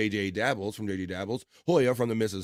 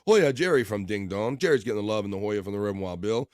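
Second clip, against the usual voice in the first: ordinary talk, nothing marked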